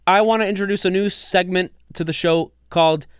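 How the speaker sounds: high, loud, hyped show host; hyped announcer. Acted. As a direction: kick off the new segment with hype. Hyped segment intro.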